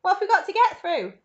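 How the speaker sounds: high, loud, alarmed demand; shouted question. A narrator's reading. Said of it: "shouted Grandpa Joe".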